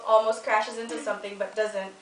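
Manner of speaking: normal talking voice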